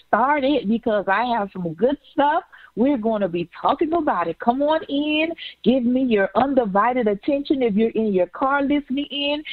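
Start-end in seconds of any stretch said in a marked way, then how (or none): none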